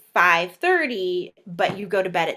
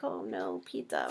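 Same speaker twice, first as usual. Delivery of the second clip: much lower, soft mock despair; guilty groan; 11 dB quieter than usual. Guilty pizza eater, low mock despair. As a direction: moan it like a confession. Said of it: "You're like".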